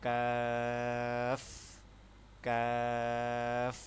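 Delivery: কাফ with a long drawn-out vowel; Arabic kaf demo. Shown as a demonstration. Teacher models কাফ for students, vowel held long. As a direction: stretch the aa of কাফ very slowly. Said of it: "আমরা যাব next হরফে".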